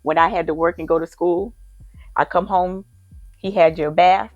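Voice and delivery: normal talking voice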